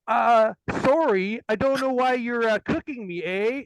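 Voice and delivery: a put-on Canadian accent begging politely; Canadian kid. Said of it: "he looks at him and goes".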